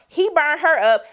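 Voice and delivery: ordinary talk, nothing marked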